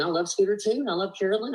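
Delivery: ordinary talk, nothing marked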